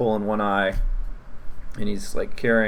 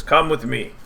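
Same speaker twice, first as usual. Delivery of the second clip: a higher goblin voice; 6 semitones higher, 4 dB louder than usual. Acted. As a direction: beckon them like a goblin guard. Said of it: "he says".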